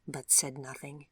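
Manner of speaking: usual speaking voice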